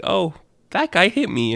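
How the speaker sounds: high little minion voice; small cartoon creature tattling; hurt minion. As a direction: pipe it up high, mildly hurt. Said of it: "And he's like".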